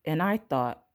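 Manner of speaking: plain reading voice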